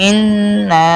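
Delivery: "ইন্না" with a long-held double n; stretched nn in "ইন্না"; doubled nun. Shown as a demonstration. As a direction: linger on the n, then release "না" in "ইন্না". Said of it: "নিজ হরফের সাথে একবার উচ্চারণ".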